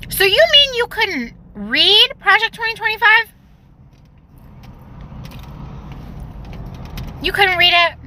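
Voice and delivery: high, incredulous sarcastic question; mocking disbelief. Real, not acted. Mocking a teacher who never read the plan, in high disbelief. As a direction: act stunned that she couldn't read it.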